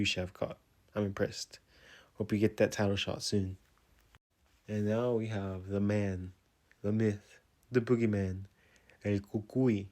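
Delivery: ordinary delivery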